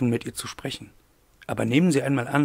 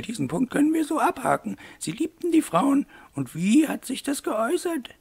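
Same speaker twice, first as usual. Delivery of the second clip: high-pitched character voice of a lady; brisk interviewing mademoiselle; 12 semitones higher than usual. A narrator's reading. Aglaé ticks off the point and questions Wolf, high brisk lady voice. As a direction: move him along in a high, businesslike lady's voice.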